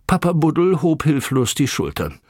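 ordinary delivery, nothing marked

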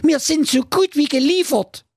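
high squeaky cartoon dwarf voice, alarmed; panicky dwarf. Dwarf Wilhelm raising the alarm, squeaky and high. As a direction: squeak it out like a tiny agent in trouble.

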